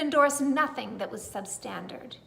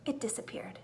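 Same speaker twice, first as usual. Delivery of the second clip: whisper; 9 dB quieter than usual. A narrator's reading. Magic vanishing.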